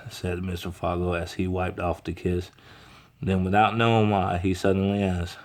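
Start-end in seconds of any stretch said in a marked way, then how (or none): none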